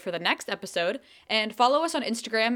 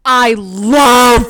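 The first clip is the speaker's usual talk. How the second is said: shouting; 4 semitones higher, 18 dB louder than usual. Real, not acted. Shouted line.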